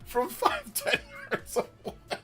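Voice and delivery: high soft exasperated, laughing at the end; incredulous squeak. Retold or imitated. Streamer scolding cop, high soft incredulous squeak. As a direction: squeak it in disbelief. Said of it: "I'm like".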